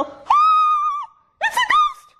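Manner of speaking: very high-pitched mock scream; girlish scream. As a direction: shriek it like frightened girls. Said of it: "They scream like girls".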